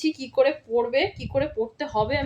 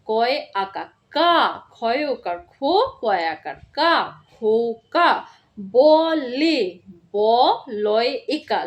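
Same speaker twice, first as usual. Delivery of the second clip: slow spelling out; slower than usual. Shown as a demonstration. Teacher spelling out a word slowly for first graders. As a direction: spell each letter slowly and clearly. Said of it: "আরেকবার বলে দিচ্ছি কিভাবে পড়তে হবে".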